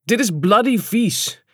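slow, drawn-out disgusted verdict; an understated cry of disgust; mildly called out. A narrator's reading. Lad after sniffing the bottle, mildly calling out. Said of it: "snoof en riep".

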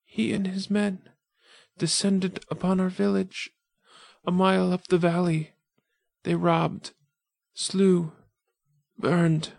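put-on high, slow girl's voice fading with each phrase; a wounded girl telling it between breaths; weakening dying girl. Dying girl to the wanderer, high and weakening. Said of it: "her voice swiftly growing weaker".

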